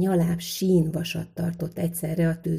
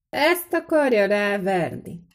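slow, high, louder scornful question; 4 semitones higher, 5 dB louder than usual. A narrator's reading. Stranger asking the smith about the horseshoe, scornful. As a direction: draw it out high with disdain. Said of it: "kérdezte fitymálóan az idegen".

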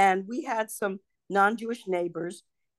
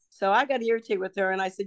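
ordinary delivery

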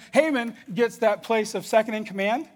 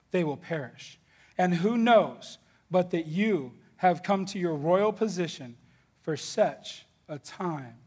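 solemn scripture reading; 2 semitones lower, slower than usual. Acted. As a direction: let each phrase land gravely.

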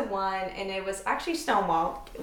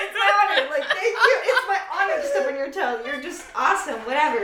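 high, gushing, giggly politeness; overly gracious; 6 semitones higher, faster than usual. Retold or imitated. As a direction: gush like an overpolite stranger. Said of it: "You're like".

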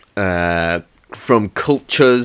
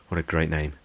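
ordinary talk, nothing marked

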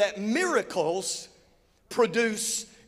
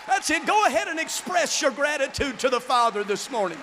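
fiery preaching; 3 semitones higher than usual. Acted. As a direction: urge the whole church to praise. Preaching at full force.

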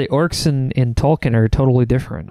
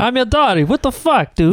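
mock Italian; 8 semitones higher than usual. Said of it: "It's so Italian".

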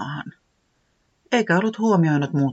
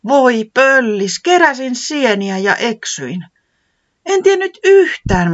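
high, louder, slow relieved lament; much higher, louder, eager relief; relieved outpouring; 9 semitones higher, 8 dB louder, slower than usual. Acted. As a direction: pour out the story to a rescuer. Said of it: "selitti helpottuneena".

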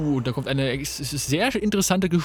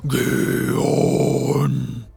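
long, loud, lower monster bellow; monster roar; 4 semitones lower, 4 dB louder than usual. Acted. The dark herald bellows again, low and drawn out. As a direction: let a deep beast cry roll out. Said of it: "Der dunkle Herold schreit".

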